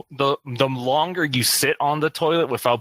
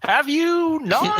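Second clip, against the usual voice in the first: drawn-out, much higher teasing "Have you not?"; mock disbelief; 14 semitones higher, much slower than usual. Real, not acted. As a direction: stretch it out slowly, sceptical and teasing.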